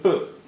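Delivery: प as a bare halant p; vowelless p. Shown as a demonstration. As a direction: say प short, with only a tiny release. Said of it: "जब ये जज़म है".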